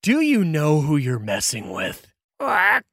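high squawks round an indignant threat; indignant bird-creature, squawking before and after; squawking Kenku challenge. Acted. Rooftop Kenku challenges Red Rhino, squawking indignantly.

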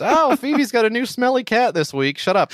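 high whiny mock of a friend. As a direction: sneer it in a high mocking voice. Mocking friend's Friends knowledge.

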